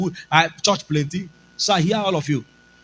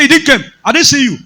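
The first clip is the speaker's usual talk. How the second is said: loud, higher flat denial; insisting boss; 8 semitones higher, 8 dB louder, faster than usual. Retold or imitated. Boss insisting the pastor missed it, loud. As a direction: insist he was absent.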